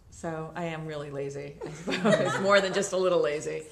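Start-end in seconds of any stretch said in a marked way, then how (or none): 1.6-3.6 louder, laughing at herself. Real, not acted. Guest laughing about being lazy with paperwork. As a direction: laugh it off, self-mocking. Laughs at her own laziness.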